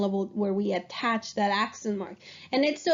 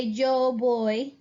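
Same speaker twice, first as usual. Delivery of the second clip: slow and careful, each word clear; teacher modelling a sentence slowly; slow model sentence; much slower than usual. Shown as a demonstration. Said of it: "I would say".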